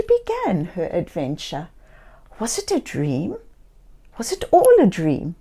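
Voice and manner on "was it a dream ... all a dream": ordinary delivery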